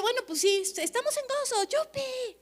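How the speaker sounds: forced cheer. Retold or imitated. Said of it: "usted dice".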